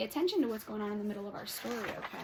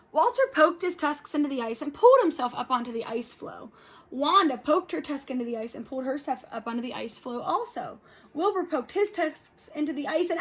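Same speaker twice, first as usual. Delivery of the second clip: lively read-aloud with character voices; storybook read-aloud for kids; reading to children; 5 semitones higher, 8 dB louder than usual. Acted. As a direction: read it to the class with expression.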